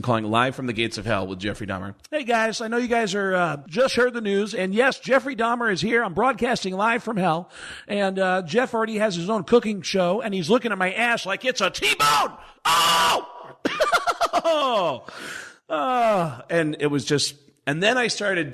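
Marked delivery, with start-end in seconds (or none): 2.1-13.3 high, shouting screamer voice from hell; Sam Kinison impression. Comic calling a radio show as a dead screamer, high frantic yelling. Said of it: "I would be on the air calling in as Sam Kinison". 13.3-14.4 high, wild cackling laugh; manic laugh. Acted. Dead comic cackling on the radio show, wild high laugh. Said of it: "calling in as Sam Kinison". 14.4-16.4 manic yelp. Acted. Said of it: "calling in as Sam Kinison".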